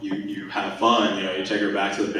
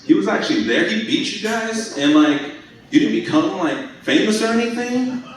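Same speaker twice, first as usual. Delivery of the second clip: incredulous inner voice; 10 semitones higher than usual. Retold or imitated. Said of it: "The second thing that pops in my mind was".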